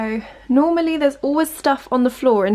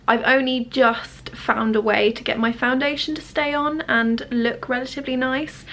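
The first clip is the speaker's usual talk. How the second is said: normal talking voice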